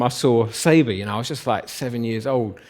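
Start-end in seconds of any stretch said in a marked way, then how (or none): none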